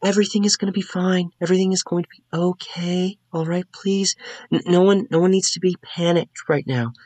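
gently pleading for calm; anxious calming. Acted. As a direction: soothe a crowd in a high, light voice. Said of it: "she is just trying to calm people".

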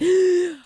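quick high intake before a sneeze; sharp gasp. Retold or imitated. Acting out the sneeze.

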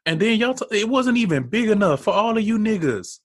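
high, loud mocking rant; excited ranting. Real, not acted. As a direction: rant about it laughing. Mocking the crowded mansion.

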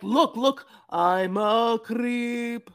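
hammed-up slow menacing confession; mock creepy Osama. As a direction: drag out each word like a self-aware creep. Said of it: "I wish that he was like".

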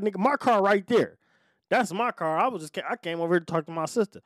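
high, insistent retold pleading; urgent protest. Parolee pointing out his own car, urgent protest.

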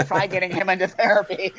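laughing. Real, not acted. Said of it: "laughs at stubborn dad".

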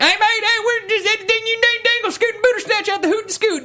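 very high, frantic squeal; Billy Bob. Retold or imitated. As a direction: squeal it out in one rushing gabble. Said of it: "when he's like".

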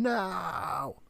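normal talking voice

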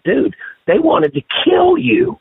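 high, slow, emphatic retold warning; urgent warning. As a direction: drive home how serious it was. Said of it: "I was like".

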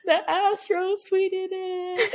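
high, slow, stretched-out whine of joy; imitated cute excitement. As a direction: mimic an overwhelmed fan, high and drawn out. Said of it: "she gets really cute about it".

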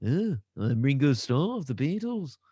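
mock Liverpool accent as a Beatle; [name] impression. Goofing as [name] in a mock Liverpool accent. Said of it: "like a beetle".

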